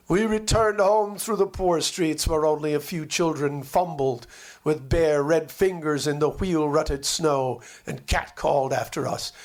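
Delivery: ordinary delivery, nothing marked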